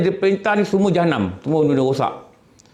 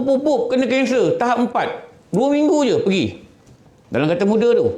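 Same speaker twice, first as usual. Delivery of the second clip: normal talking voice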